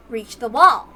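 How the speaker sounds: ordinary delivery, nothing marked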